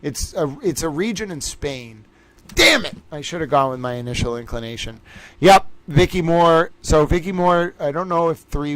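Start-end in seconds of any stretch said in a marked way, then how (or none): 2.5-3.0 sudden high yelp of annoyance; frustrated outburst. Real, not acted. Host missing a trivia answer he half knew, high frustrated yelp. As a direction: spit it out, kicking yourself.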